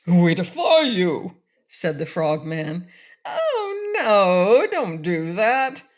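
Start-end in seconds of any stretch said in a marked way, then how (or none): 0.1-1.4 defiant. A narrator's reading. Frogman defies. 3.2-5.8 high, pleading woman's voice. A narrator's reading. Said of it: "pleaded Cake".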